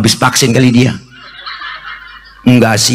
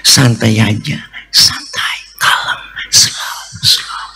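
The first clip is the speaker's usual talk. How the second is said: calming down; 5 semitones lower, slower than usual. Retold or imitated. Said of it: "Nabi nggak marahan".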